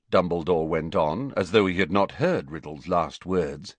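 usual speaking voice

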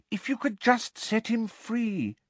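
put-on high, coaxing girl's voice; hopeful. A narrator's reading. Hermione suggesting the elf be freed, hopefully. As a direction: suggest it eagerly, pitched high. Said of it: "said Hermione hopefully".

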